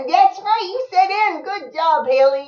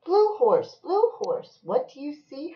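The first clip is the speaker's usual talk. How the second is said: high, bouncy picture-book refrain; singsong storybook call; reading to a child; 9 semitones higher, 8 dB louder than usual. Acted. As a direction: swoop down on each animal name.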